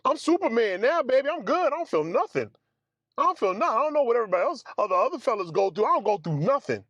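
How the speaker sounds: high, boastful Superman swagger; patient bragging he needs no painkillers, high and cocky. Retold or imitated. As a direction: crow like nothing can hurt you. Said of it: "I was like".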